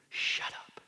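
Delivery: hushed order that allows no argument; authoritative whisper. Acted. Jesus talking to the demons, authoritative whisper. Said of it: "Takes authority over that demonic power".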